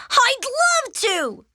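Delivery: very high, slow, stretched acceptance; thrilled child's voice; overjoyed. Acted. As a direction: gush with delight at a gift. Arthur offered a puppy.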